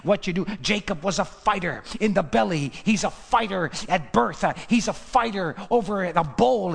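ordinary talk, nothing marked

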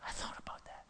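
hushed whisper; whispered, lost in thought. Real, not acted. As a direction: breathe it out in a whisper. Whispered reflection.

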